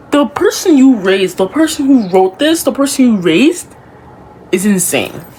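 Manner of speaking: loud, high indignant repeat; outraged. Real, not acted. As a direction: call them out loudly. Outraged at parents.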